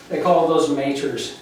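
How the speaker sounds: ordinary talk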